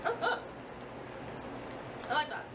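ordinary talk, nothing marked

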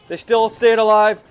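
normal talking voice

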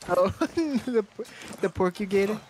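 usual speaking voice

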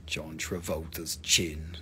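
low, slow, soft murmur; deep hushed awe; hushed repeat. Pretend. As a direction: say it low and slowly under your breath. Same joke repeated under breath.

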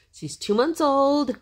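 high, slow sing-song. Real, not acted. Cooing to baby.

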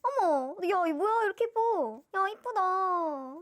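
loud, high-pitched fan gushing; real thrilled admiration; delighted surprise. Real, not acted. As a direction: gush loudly, drawing out the last word. First look at pretty album.

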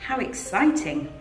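ordinary delivery, nothing marked

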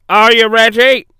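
loud, high commanding call. A narrator's reading. Controller directs the two engines, loud calling. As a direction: call the order, drawing out the heave. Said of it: "called the fat controller".

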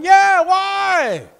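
high shout, long drawn "why"; loud eager demand. Retold or imitated. Preacher answering God's question in his story, high loud demand. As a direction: shout back up at God.

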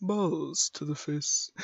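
low, drawn-out mechanical line; badly faked robot, slow and quiet; robotic voice. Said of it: "I can't do a robotic voice".

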